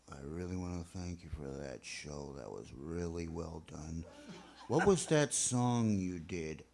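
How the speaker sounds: a hint of an elderly fan thanking you; old man voice. Old man quoted after show.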